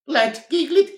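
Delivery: laughing through words; giggly boot; giggling. Acted.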